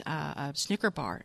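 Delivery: secret whisper, low and hushed. Acted. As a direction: hush it like a kid's secret. Child sharing a secret.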